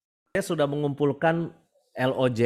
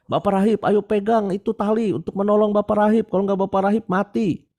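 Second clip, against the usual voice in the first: shouting from helicopter; 9 semitones higher than usual. Retold or imitated. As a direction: yell down from the helicopter. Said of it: "dari helikopter diteriakin". (teriakan dari helikopter)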